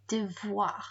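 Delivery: "Devoir" ending in an open "wa"; wa sound. Shown as a demonstration. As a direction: softly model Devoir with "wa" for oir. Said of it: "That's that wa sound again".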